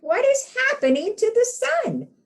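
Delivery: very high, loud frightened question; alarmed duck. Acted. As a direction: duck in a panic.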